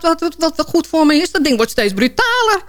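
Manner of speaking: ordinary delivery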